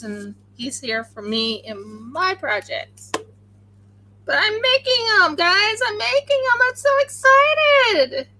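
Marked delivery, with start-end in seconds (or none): 4.2-8.3 high, loud giddy excitement; excited squeal. Real, not acted. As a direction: squeal it with joy. Excited about making dice.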